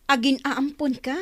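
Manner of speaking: normal talking voice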